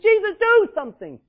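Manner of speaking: very high, loud plea; a mother crying out to Jesus for help; desperate begging. Acted. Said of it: "she had begged Jesus".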